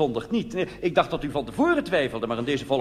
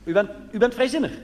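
surprised; 10 semitones higher, 4 dB louder, faster than usual. Acted. Surprised discovery on the phone.

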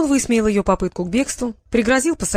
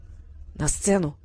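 whispered; 4 semitones lower, 7 dB quieter, slower than usual. A narrator's reading. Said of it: "прошептал Бамбура".

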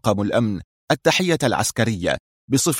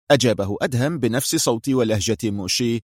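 ordinary delivery, nothing marked

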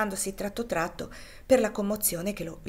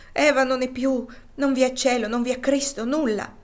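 louder and higher, rising despair; despairing outburst; 4 semitones higher, 5 dB louder than usual. Acted. St. Clare to Tom, grieving loss of faith, raised despairing voice. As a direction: let the denial swell into a cry.

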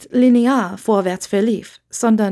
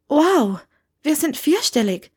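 plain reading voice

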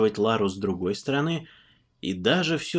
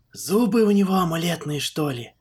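put-on puzzled, raised young voice; surprised; 7 semitones higher, 4 dB louder than usual. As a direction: wonder aloud at it. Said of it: "удивился Арчи".

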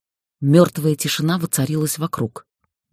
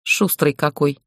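ordinary delivery, nothing marked

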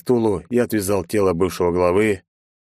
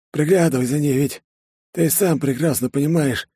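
higher, strained voice of a dying man; dying chief pleading; 6 semitones higher than usual. A narrator's reading. Dying chief entrusts Minami to Ichiro, strained pleading. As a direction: plead earnestly with your last breaths.

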